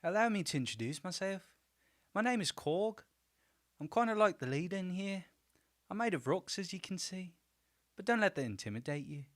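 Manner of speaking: put-on high, polite Kiwi accent; Korg. Korg introducing himself to newcomers, Kiwi accent. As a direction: introduce yourself in a high New Zealand voice. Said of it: "with that accent".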